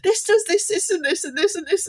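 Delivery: put-on a boy proudly setting mum straight; excited son. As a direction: son insisting to mum, thrilled. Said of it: "he said".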